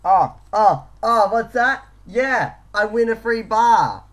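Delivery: loud, high thrilled cheer; excited shouting. Real, not acted. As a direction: shout with real delight at winning. Winning a free Mars bar.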